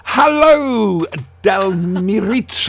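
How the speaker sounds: high, loud sing-song hello; mock grand greeting. Host hails her co-host by a silly new name, high and loud. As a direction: greet him like a pompous host.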